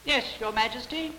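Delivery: put-on small, high old man's voice; little old man. Little old man answers the king, high small voice. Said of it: "stood a little old man".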